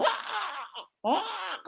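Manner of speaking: teriakan tiruan melengking tapi tertahan; tiruan teriakan kerasukan. Retold or imitated. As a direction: pekik tinggi yang ditahan pelan. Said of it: "lain teriakannya ini". (tiruan teriakan orang kerasukan)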